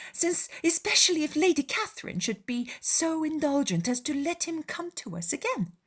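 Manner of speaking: high-pitched, mock-approving father; wry Mr Bennet. Father commenting on the clergyman's letter, drily mock-impressed.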